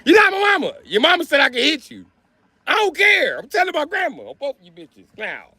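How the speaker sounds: put-on high, shouting teacher scolding a kid; hammed-up angry teacher yelling; yelling at a student.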